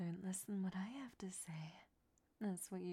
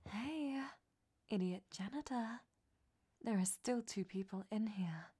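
calling out; 2 semitones higher, 7 dB louder than usual. Acted. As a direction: shout for the janitor to hear. Calling to the janitor outside.

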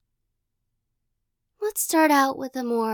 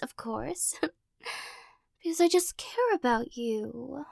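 teasing possessive captor. Acted. Captor pinning the listener against a wall, playful menace. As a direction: toy with them like a cat with a mouse.

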